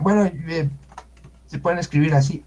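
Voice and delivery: normal talking voice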